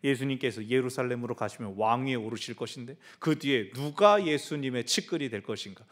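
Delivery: ordinary talk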